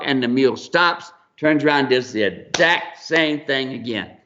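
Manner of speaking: usual speaking voice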